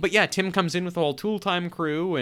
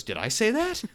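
surprised innocent question; 9 semitones higher than usual. Retold or imitated. Al caught after his own joke.

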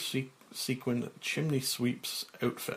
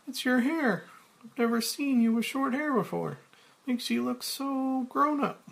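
high, fast woman's voice; Claire; 13 semitones higher than usual. Acted. Claire noticing Frank's change, in a high voice.

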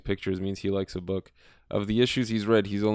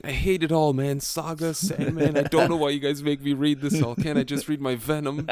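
whiny Rusty; 6 semitones higher than usual. Retold or imitated. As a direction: complain like a sulky kid. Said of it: "he's saying".

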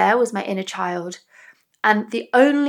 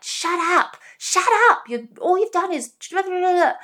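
high, sharp mock snapping at a crying kid; imitating annoyed parent; 9 semitones higher than usual. Annoyed parent snapping at hungry child in car, high mock anger. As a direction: play the fed-up parent snapping shut up. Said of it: "your parents are getting annoyed and they're like".

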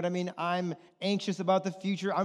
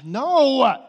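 mock defensive no; 6 semitones higher, 10 dB louder than usual. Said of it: "defensive retort to wife".

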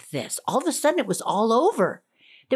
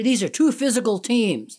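American commentator quote. Retold or imitated. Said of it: "you often hear".

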